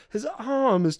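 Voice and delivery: a touch of disbelief; puzzled Robert. Retold or imitated. Robert repeats her story back, lightly puzzled. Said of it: "And he's like".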